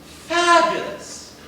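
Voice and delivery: high, loud, slow gush; exuberant. Acted. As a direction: burst with delight. Exuberant answer.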